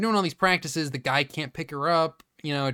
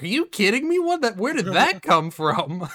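higher and louder, outraged cheerleader; indignant disbelief; 5 semitones higher, 4 dB louder than usual. Retold or imitated. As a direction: raise it, stunned and offended. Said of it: "And she's like".

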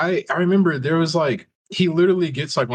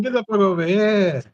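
mock Italian accent; 7 semitones higher than usual. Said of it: "he went Italian on us".

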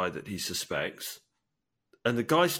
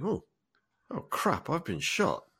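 higher, understated dawning realisation; inner monologue; 6 semitones higher than usual. Acted. Man suddenly realising he's been shot, understated inner monologue. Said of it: "hear the monologue in his head".

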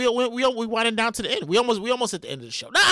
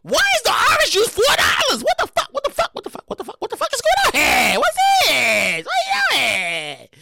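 outraged shopper; 10 semitones higher, 4 dB louder, slower than usual. Retold or imitated. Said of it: "And I was like".

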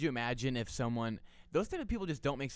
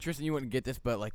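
normal talking voice

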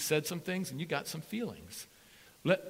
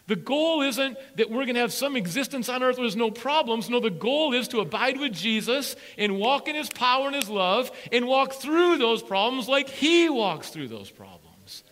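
loud preaching swell; 6 semitones higher, 7 dB louder than usual. Acted. Preacher urging the church to abide with Jesus. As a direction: drive it home to the church.

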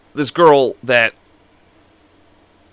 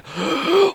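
imitated sharp, high gasp for air; winded, pained intake of breath; struggling gasp; 14 semitones higher, 5 dB quieter than usual. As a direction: suck in air as if you can barely breathe. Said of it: "I could barely breathe".

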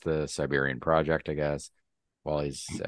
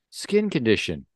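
put-on higher, louder Russian-accented suggestion; 5 semitones higher, 3 dB louder than usual. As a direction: offer it matter-of-factly in a raised Russian voice. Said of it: "Can you set your Russian accent".

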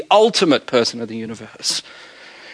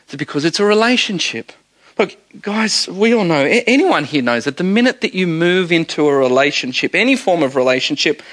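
ordinary talk

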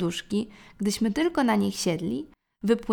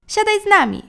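high, loud eager call; eager child; 11 semitones higher, 9 dB louder than usual. A narrator's reading. Youngest brother urges dad to join, high and loud. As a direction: squeal it like an excited little boy.

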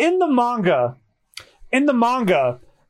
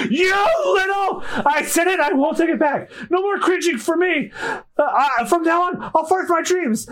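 defiant shout; 8 semitones higher than usual. Acted. Kobe yelling that he won't back down from Alvida. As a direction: shout it, half laughing at yourself.

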